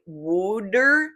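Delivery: wooder with the vowel of wood, drawn out; произношение «wooder». Shown as a demonstration. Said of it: "When people spell it out, it's like wooder".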